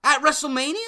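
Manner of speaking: loud incredulous question; outraged. Real, not acted. Fan ranting at WWE booking, loud outrage.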